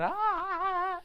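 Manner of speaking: high yelped ha; a single high mocking laugh. Class laughing at him.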